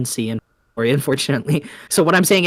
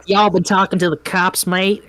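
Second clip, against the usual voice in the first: put-on higher, half-hearted mixed accent; mock Aussie try; 6 semitones higher than usual. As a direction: toss off a lame Aussie-Southern blend. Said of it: "So I'm gonna be like".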